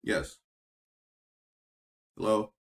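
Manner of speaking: usual speaking voice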